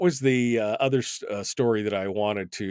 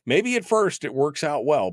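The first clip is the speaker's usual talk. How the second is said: normal talking voice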